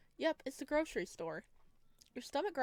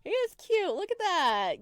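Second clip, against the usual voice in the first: much higher and louder, giggling; excited fangirling; 5 semitones higher, 13 dB louder, slower than usual. Real, not acted. Player gushing over Lou on screen, loud giggly squeals. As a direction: squeal over how cute he is.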